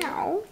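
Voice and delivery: put-on high playful meow for a toddler; cat meow. Shown as a demonstration. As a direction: mew like a kitten. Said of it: "What's a kitty cat say?".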